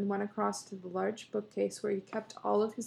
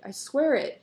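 louder, proud declaration; 4 semitones higher, 6 dB louder than usual. A narrator's reading. Husband proudly insists to his wife, louder and pleased with himself. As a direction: swear it with a proud puff. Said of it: "he said proudly".

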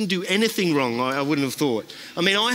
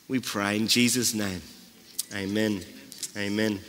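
spoken prayer; 3 semitones lower than usual. Acted. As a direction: talk to God humbly and sincerely. Pastor leads a spoken prayer.

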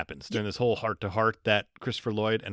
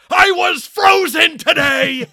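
high, drawn-out outraged yell; full-force hammed-up movie shout; Christopher Lloyd yelling; 11 dB louder, much slower than usual. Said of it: "where Christopher Lloyd yells out".

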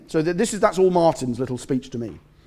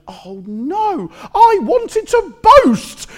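put-on much higher, louder, slow drawn-out childish wail; angry child whine; 9 dB louder, much slower than usual. Nephew upset he can't boast to his uncle, with a loud high childish wail. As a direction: wail it like a thwarted kid. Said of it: "He was really angry".

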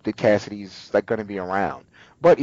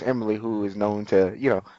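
ordinary talk

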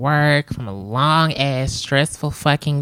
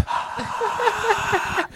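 high laugh. Real, not acted. Laugh after cheer.

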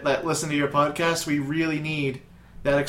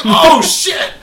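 much higher, louder laughing gasp; hammed-up surprise; mock shocked; 14 semitones higher, 11 dB louder than usual. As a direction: caught off guard by the news. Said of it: "They're like".

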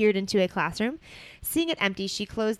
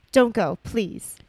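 pleading; 2 semitones higher, 8 dB louder, much slower than usual. Acted. Ron pleading.

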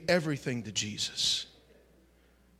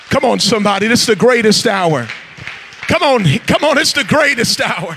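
loud call-and-response preaching; rousing pulpit exhortation; fiery preaching; 5 semitones higher, 14 dB louder than usual. Real, not acted. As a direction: stir up the congregation. Preacher works up the congregation.

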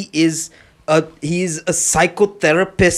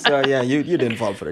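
usual speaking voice

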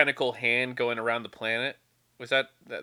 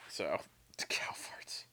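whispered aside; 8 semitones lower, 15 dB quieter, slower than usual. Real, not acted. Deadpan aside on cow farts.

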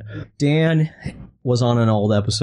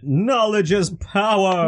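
high, slow mock Cajun line; slow, lifted movie-quote voice; mock Gambit line; 7 semitones higher, much slower than usual.

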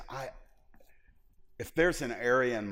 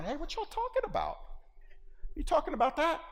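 much higher, sly sauntering voice; smug know-it-all; faster than usual. Retold or imitated. Preacher mocking a know-it-all joining a talk, high smug. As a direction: drop in like a cocky expert.

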